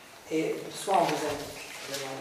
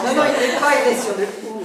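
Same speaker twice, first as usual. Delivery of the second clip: loud, higher, laughing insistence; laughing protest; 6 semitones higher, 11 dB louder than usual. Real, not acted. Scholar objecting to colleague over accent, loud and laughing. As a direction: cry out your objection through laughter.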